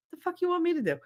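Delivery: high, squeezed protest; mock exasperated retort. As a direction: whine back in disbelief. Retold exasperated retort to caller.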